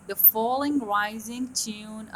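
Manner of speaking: very slow, stretched; slow naming. Shown as a demonstration. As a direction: spell out the term slowly. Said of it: "يسموها the dive".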